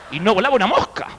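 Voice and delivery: usual speaking voice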